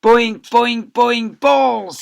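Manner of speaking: loud, high bellow; teacher bellowing among bouncing balls. Acted. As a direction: roar it as balls hit him. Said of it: "he bellowed".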